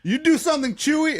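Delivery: high-pitched mock challenge; imitated yelling. Uber driver daring the trucker to act, mock yelling. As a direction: dare him, voice pushed way up. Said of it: "yelling through the window".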